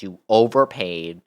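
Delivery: normal talking voice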